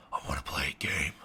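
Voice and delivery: low, menacing whisper; rasped villain whisper, very low; Jigsaw. Shown as a demonstration. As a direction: whisper it like a horror-movie villain. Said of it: "Jigsaw voice".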